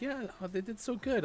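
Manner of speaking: high chirpy teacher praise; sing-song teacher. Retold or imitated. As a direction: gush like a cheery teacher. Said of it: "the teacher's always like".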